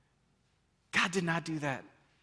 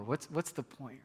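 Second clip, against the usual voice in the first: defeated whisper; 3 semitones lower, 7 dB quieter, slower than usual. Retold or imitated. Despairing retold thought.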